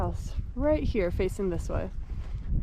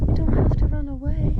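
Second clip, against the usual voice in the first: high, a bit louder cooing plea; 7 semitones higher, 4 dB louder than usual. Real, not acted. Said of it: "Don't mind my baby talk".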